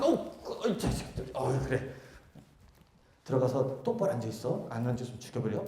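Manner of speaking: soft, low teasing threat under the breath; affectionate mutter. Acted. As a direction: grumble fondly so only the kid hears. Master quietly threatens child.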